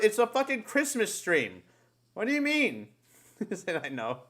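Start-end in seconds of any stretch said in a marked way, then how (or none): none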